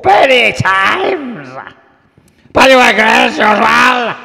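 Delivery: put-on high, very loud screech with a Russian accent; drawn-out feline shriek, put-on Slavic accent; Russian screaming cat. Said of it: "some sort of Russian screaming cat".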